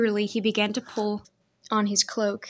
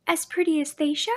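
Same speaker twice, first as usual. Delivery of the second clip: put-on young girl voice; 7 semitones higher than usual. A narrator's reading. Girl's question.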